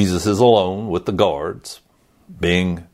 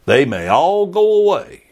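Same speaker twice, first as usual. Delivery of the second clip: higher-pitched, acted boast of loyalty; bold pledge; 9 semitones higher, 3 dB louder than usual. Preacher playing Peter at the dinner party, boldly loyal. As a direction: pledge it like an overconfident disciple. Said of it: "and you're like".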